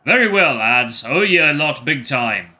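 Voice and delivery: hearty Scots thanks to the lads; Scottish bovine. Acted. Bovine thanks the bear's group, loud light Scottish accent. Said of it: "different accent for this guy".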